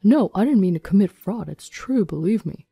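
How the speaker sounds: desperate plea in a put-on man's voice; pleading. Husband denies fraud as he is arrested, pleading. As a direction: plead to be believed, starting loud. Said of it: "ignoring Alden's pleas".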